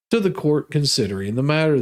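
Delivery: normal talking voice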